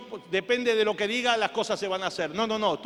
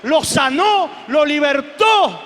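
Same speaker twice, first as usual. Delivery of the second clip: loud, high-pitched proclamation building on each phrase; shouted testimony climax; full-force preaching; 5 semitones higher, 9 dB louder than usual. Real, not acted. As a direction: proclaim a miracle to the whole congregation.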